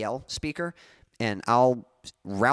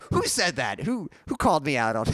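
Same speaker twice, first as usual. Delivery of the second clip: higher, mock-indignant New York accent; Aunt Kathy; 5 semitones higher than usual. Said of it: "and she's like".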